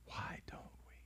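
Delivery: breathy unvoiced whisper. Real, not acted. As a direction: lean in and whisper the question. Pastor drops to a whisper.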